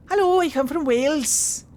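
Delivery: high, loud singsong Welsh lilt; mock Welsh accent. Streamer mocking the companion's accent, hammed-up Welsh. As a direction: ham up a Welsh greeting. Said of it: "Wait till the Welsh accents".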